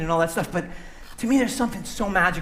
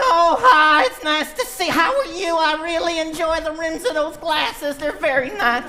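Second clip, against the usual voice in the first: much higher, slower, giggly sweet little voice; sweet character voice; 8 semitones higher, 4 dB louder than usual. Acted. Puppeteer shows a second character, high sweet giggly voice. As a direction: gush like a shy, delighted little creature.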